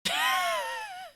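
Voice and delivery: a sudden shrieking cackle of delight; burst of laughter. Real, not acted. Sudden burst at game event.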